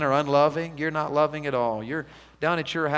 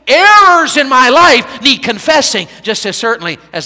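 louder and higher, a climbing sermon cry; preaching at full force; 7 semitones higher, 11 dB louder than usual. Acted. A revival preacher pressing the congregation on errors, full force.

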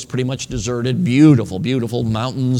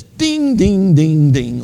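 imitated metallic dinging; 3 semitones higher, 6 dB louder, much slower than usual. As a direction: mimic a nut clinking down the bolt, loud. Imitating nut spinning down a bolt.

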